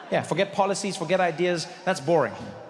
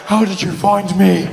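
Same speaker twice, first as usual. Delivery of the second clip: breathless spy; 9 dB louder, slower than usual. Acted. Bond cornered, panting in alley.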